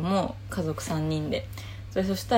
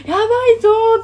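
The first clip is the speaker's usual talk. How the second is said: panicked shout; 8 dB louder than usual. Retold or imitated. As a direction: call out the alarm to the family. Panicking after being overheard.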